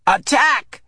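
high, loud battle cry; shout. A narrator's reading. Child shouting attack to start the game. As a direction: yell the charge across the field. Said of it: "The shout came from".

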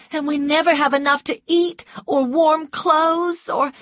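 high, loud distressed woman; desperate Scarlett, pleading. A narrator's reading. Scarlett confesses the family's hardship to Rhett, desperate. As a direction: pour out the troubles in a high, urgent plea.

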